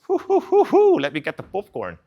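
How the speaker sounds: nosy bystander in the crowd hooting with excitement; gleeful onlooker. Retold or imitated. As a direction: whoop like a spectator settling in for a show. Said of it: "and you're like".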